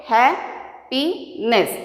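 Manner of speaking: "Happiness" slowly in three parts; broken into syllables. Shown as a demonstration. Teacher counting parts for learners, "Happiness" syllable by syllable. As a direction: give each syllable of "Happiness" its own beat. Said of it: "How many parts we have made?".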